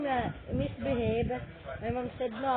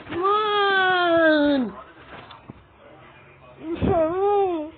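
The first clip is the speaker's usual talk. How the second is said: frantic high screams, much louder; terrified horror victim; 4 semitones higher, 6 dB louder, much slower than usual. Real, not acted. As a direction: yell in panic at the screen. Panicked screaming at the game.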